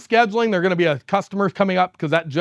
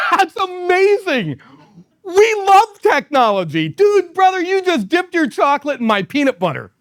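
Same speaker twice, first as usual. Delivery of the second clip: over-the-top enthusiasm; 10 semitones higher than usual. Retold or imitated. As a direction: answer the hostile customer with beaming hype. My response was this.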